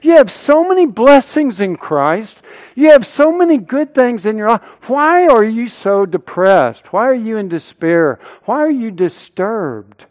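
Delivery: high, insistent questioning, easing toward the end; self-interrogation. Acted. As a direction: scold your own soul with pleading questions. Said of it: "he first begins by interrogating himself".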